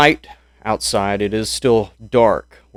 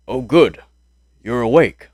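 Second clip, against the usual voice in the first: a hint of a gruff soldier, a bit louder; Stone Hayes voice; 4 dB louder than usual. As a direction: greet the waking comrade as Stone Hayes. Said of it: "That's what he sounds like".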